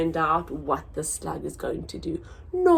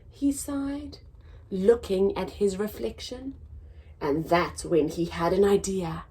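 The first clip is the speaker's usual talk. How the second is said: lively storybook reading, big swoops in pitch; reading to children; 7 semitones higher, 6 dB louder than usual. A narrator's reading. Teacher reads to children, bright storybook voice. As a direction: read it out to little listeners. Reads picture book aloud.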